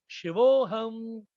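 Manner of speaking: long drawn-out, slow greeting; phone greeting. Shown as a demonstration. Teacher showing students how to answer calls, drawn-out phone greeting. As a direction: stretch it out as if answering a call. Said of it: "From tomorrow you pick the phone".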